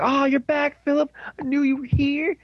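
very high-pitched, overjoyed squeal of welcome; imitated excitement. Detective thinking his partner is back, acted-out excitement. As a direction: greet a lost friend in a hammed-up high voice. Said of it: "he gets all excited".

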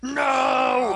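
mock shout of protest. Fans crying out at the news, high mock shout. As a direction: shout no like a crushed fan.

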